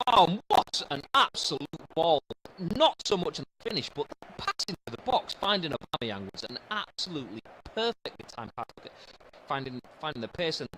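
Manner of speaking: loud, high burst of praise for a pass; excited commentary. Acted. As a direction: call the chance live, thrilled. Live commentary on a goal chance.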